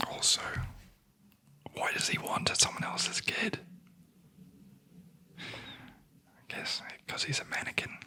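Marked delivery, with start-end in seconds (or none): none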